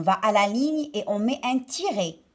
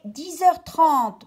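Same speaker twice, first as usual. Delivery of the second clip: slow, deliberate answer; 5 semitones higher, 4 dB louder, much slower than usual. Acted. Mother's answer reread slowly.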